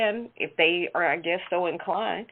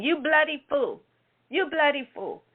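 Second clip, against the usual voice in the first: put-on Australian-accented duck; 7 semitones higher than usual. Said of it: "it had an Australian accent".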